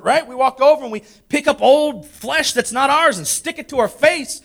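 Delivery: high-pitched, impassioned pulpit delivery; full-force preaching. Real, not acted. Preacher telling the church to put off the old self, full-force preaching.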